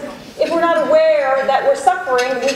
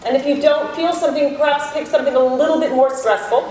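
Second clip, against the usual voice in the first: guided meditation. Acted. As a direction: ease the room gently inward, unhurried. Leads a self-compassion meditation.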